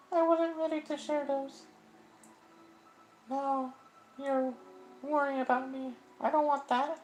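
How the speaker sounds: a high voice pushing help away; high girlish distressed voice; Sayori's voice. Acted. Voicing Sayori.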